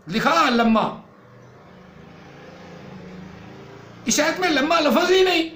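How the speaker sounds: angry rant. Acted. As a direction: shout down the rival who misreads. Scolding a rival preacher. (اونچی آواز میں غصے سے ڈانٹنا)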